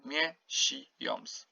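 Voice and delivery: miesiąc drawn out slowly, ą like om; slow demonstration. Shown as a demonstration. Teacher to student, slowly demonstrating miesiąc. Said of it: "this is how you pronounce it".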